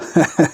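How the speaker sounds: short chuckle. Real, not acted. Friend admitting they still await love, short chuckle. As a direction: let out a brief laugh. Light laugh after waiting for love.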